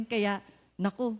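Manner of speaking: ordinary talk, nothing marked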